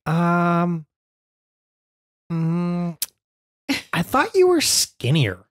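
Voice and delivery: lightly voiced hesitant child, drawn out; daughter's voice. Dad imitating his daughter's hesitant little voice.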